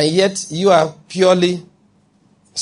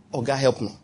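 hushed prayer; 3 semitones lower, 8 dB quieter than usual. Retold or imitated. Believer's quick prayer against temptation.

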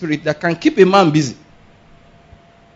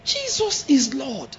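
slow, higher startled praise; shocked exclamation; 8 semitones higher, 6 dB quieter, much slower than usual. Shown as a demonstration. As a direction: let it out in surprise. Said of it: "let them hear it".

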